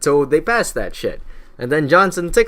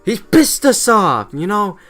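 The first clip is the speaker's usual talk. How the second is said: hammed-up Congress losing patience; furious Congress; 7 semitones higher than usual. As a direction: Congress fed up and vowing to impeach the President. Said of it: "they're like".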